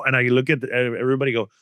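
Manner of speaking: usual speaking voice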